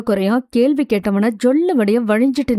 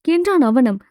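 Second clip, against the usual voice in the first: usual speaking voice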